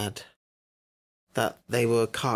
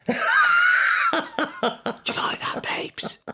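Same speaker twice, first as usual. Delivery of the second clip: breathy playful whisper; slower than usual. Pretend. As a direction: tease in a whisper.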